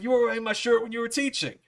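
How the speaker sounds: high, imitating an eager star; excited recognition. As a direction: pitch it up as the memory clicks. Star keeps recognizing him.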